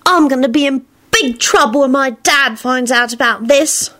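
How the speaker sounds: put-on high, glum boy voice; worried Teddy. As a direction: fret like a child in trouble. Teddy worries about his dad.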